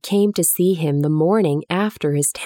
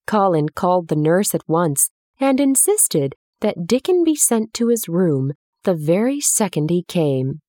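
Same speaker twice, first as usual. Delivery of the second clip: usual speaking voice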